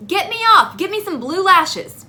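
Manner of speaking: high, loud frustrated demand; exasperated shout. Retold or imitated. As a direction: yell it out, comically desperate. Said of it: "I'm like".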